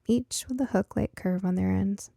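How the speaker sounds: plain reading voice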